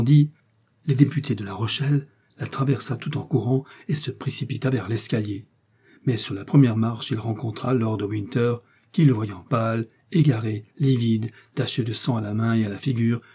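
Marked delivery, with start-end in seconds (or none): none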